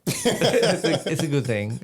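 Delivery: high laughing repeat of "good thing". Real, not acted. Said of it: "It's a good thing. (repeats)".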